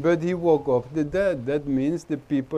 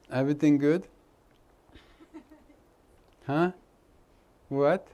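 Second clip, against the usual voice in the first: usual speaking voice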